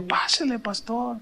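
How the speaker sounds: invitación muy bajita y algo aguda; bienvenida discreta. Retold or imitated. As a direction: hazlo pasar casi sin voz. Recibe al pastor en casa. (bienvenida en voz baja)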